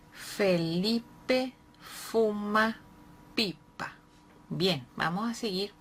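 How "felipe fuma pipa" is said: Felipe fuma read very slowly, word by word; slow, careful classroom reading. Shown as a demonstration. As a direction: read it out slowly for a child to follow. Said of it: "teacher models slow reading".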